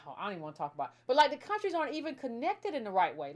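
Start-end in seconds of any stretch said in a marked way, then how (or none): 0.0-0.9 sighed, quiet and rushed throwaway; exasperated sigh. Real, not acted. Host groans at the topic, a quick soft exasperated aside. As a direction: brush it off with a tired sigh, fast and low.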